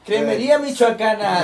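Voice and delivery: high, loud promo voice; projected playful announcer; mock store announcer. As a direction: parody a shop's loudspeaker pitch.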